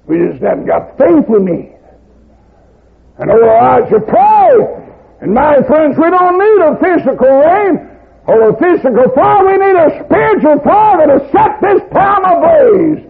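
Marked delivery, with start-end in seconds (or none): 3.2-13.0 much higher, louder rising cry; preaching at full force. Acted. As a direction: build the fire in the crowd. Preacher builds to climax.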